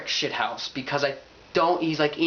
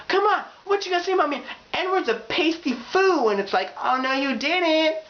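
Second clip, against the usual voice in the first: put-on high, fast challenging girl; sassy high voice; 11 semitones higher than usual. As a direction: square up and challenge her. Catfight girls confronting each other.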